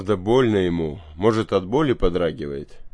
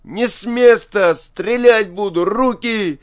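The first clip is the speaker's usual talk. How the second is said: high, loud panicked commands; boy's desperate armed warning, yelled; frantic shout; 12 semitones higher, 7 dB louder than usual. A narrator's reading. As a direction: threaten at the top of a strained high voice. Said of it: "кричит Илька".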